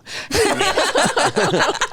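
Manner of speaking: big high-pitched burst of laughter. Real, not acted. Laughing at own joke.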